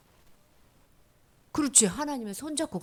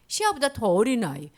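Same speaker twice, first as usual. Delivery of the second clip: usual speaking voice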